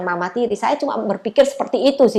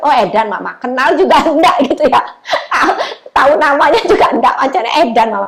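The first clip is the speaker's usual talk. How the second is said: louder, laughing mock disbelief; son teasing, laughing; 4 semitones higher, 7 dB louder than usual. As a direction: tease mom through laughter. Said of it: "Anakku bilang lagi".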